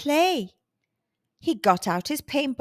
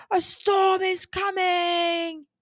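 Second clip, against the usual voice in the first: slow booming shout from a hidden voice; roaring warning; 6 semitones higher, slower than usual. Acted. Loud voice warns Morton of the storm, booming shout. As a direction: let it roar out slowly. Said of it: "A loud voice roared out".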